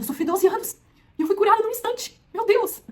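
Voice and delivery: high, loud astonished cry; overwhelmed joy. Acted. Healed woman marveling at her instant cure, high and loud. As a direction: let the wonder burst out high.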